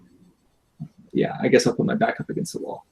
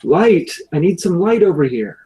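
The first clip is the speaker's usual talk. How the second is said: loud call for light; 5 semitones higher, 8 dB louder than usual. Acted. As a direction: shout it across the dark. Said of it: "call out".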